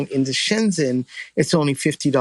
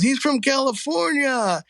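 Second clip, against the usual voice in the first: approving cop; 9 semitones higher than usual. Retold or imitated. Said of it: "the other cops look around and goes".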